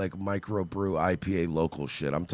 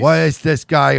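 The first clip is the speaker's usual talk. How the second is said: mocking odd speech; 5 semitones higher, 11 dB louder than usual. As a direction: mimic him loudly, stretching the words. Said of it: "why does he talk like this".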